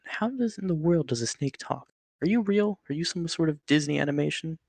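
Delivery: grasping at any explanation; disbelieving. Acted. Man asks the snake if it's real, pitched high with disbelief.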